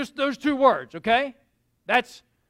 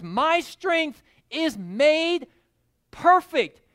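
slow scripture quote; 3 semitones higher, 4 dB louder, slower than usual. Acted. Quoting Jesus slowly.

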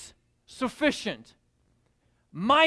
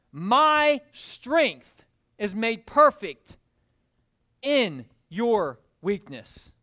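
slow, weighty words of Jesus; solemn slow declaration; 4 dB louder, slower than usual. Acted. Jesus answering Paul, slow and solemn. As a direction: stretch each word out with authority.

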